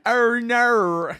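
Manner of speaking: loud, high Australian "no" bent into "naur"; hammed-up, badly faked Australian accent; mock Australian accent. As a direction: exaggerate the Australian vowel in "no". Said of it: "they pronounce no, N A U R".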